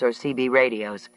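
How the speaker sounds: ordinary delivery, nothing marked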